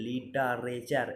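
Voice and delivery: Literature stretched out into separate syllables; slow syllable-by-syllable. Shown as a demonstration. Teacher breaks the word down for spelling, slow syllable-by-syllable. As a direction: sound out literature very slowly.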